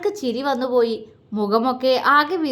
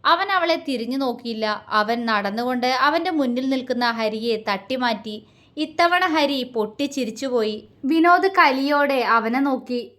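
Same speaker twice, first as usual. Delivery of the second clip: ordinary delivery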